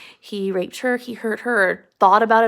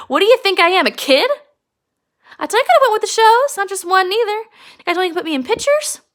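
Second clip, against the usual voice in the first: loud, high, fast rant at the men; bitter rant; 5 semitones higher, 6 dB louder, faster than usual. Acted. Curley's wife scolds the men in the barn, shouting loud and high. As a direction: throw every grievance at them, shouting.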